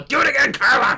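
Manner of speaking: imitating a bossy director calling out; mock director yell. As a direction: yell the order across the set. Imitating director yelling at actress.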